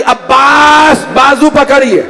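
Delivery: high, drawn-out call to a brother; impassioned re-enacted call. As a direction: summon him with a long ringing cry. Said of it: "حسین نے آواز دی".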